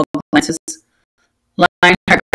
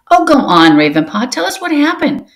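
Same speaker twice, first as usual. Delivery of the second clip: calling out; 8 semitones higher than usual. Acted. She-cat begs Ravenpaw for the battle story, high and eager. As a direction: coax him to tell the story.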